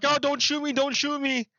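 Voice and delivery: high-pitched panicked begging, acted out; mock pleading. Clerk pleading with robber in retelling.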